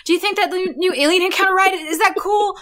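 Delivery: high, fast needy dad voice; hammed-up dad begging his son for praise; eager stepdad. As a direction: fish for approval like a clingy dad. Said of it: "Michael Eisner's just like".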